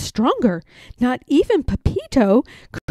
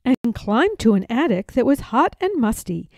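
ordinary delivery

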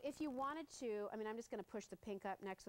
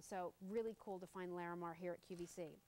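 ordinary talk

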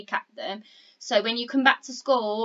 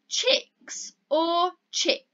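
slow choice; 6 semitones higher, much slower than usual. Shown as a demonstration. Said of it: "Which one do you think".

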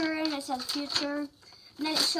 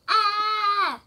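mock scream; 6 semitones higher, 4 dB louder than usual. Character screams as it explodes.

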